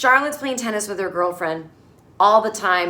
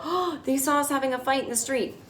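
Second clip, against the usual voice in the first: higher mock gasp, scandalised; a touch of gossipy horror, pitched up; mock shock; 5 semitones higher, 4 dB quieter than usual. Couple worry friends saw them fighting, mock shocked.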